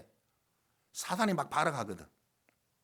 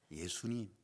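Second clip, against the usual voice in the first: normal talking voice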